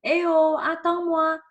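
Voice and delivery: hey, wait for me, called down the street; calling to a friend. Retold or imitated. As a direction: call out to a friend walking ahead. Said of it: "Quand tu veux dire".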